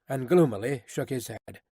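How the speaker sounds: usual speaking voice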